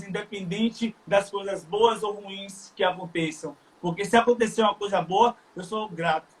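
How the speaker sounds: ordinary talk, nothing marked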